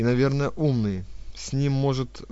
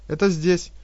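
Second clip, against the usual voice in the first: fawning; 6 semitones higher, 7 dB louder than usual. Acted. Fawning wife voice.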